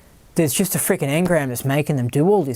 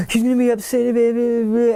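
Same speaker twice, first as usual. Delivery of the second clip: higher, panicky mock worry; 6 semitones higher than usual. Mocking a fearful man about the girl, high panic. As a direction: imitate him fretting, pitched up. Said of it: "but he was like".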